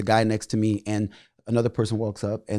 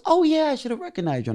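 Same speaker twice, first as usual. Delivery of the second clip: high-pitched phony recognition; mock schmoozing in a much higher voice; fake Hollywood gushing. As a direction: mimic an insincere industry greeting, pitched way up. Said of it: "So fake, so Hollywood".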